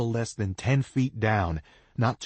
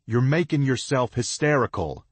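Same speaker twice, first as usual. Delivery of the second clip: calling down; 4 semitones higher, 5 dB louder than usual. A narrator's reading. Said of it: "I call down in a voice".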